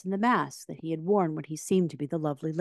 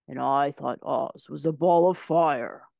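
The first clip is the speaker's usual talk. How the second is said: lion's voice; 4 dB louder than usual. A narrator's reading. The lion recalling the ball of fire, slow and heavy. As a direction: give it the lion's heavier sound. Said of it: "exclaimed the lion".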